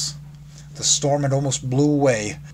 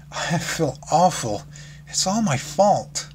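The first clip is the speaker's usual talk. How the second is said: higher, slow, teary apology; teary Fluttershy; 5 semitones higher, slower than usual. Acted. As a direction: say it slowly through held-back tears. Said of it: "a few stray tears escaping her eyes".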